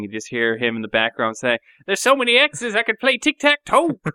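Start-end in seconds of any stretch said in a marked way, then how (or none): 1.9-4.0 high, put-on Southern twang for a game quip; Southern accent. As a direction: imitate a Southern buddy joking in the background. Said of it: "he had a great way of talking".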